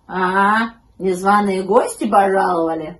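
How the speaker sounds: lightly voiced grumpy dog; grumbling dog. A narrator's reading. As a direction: grumble loudly at the intruders. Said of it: "проворчал пёс".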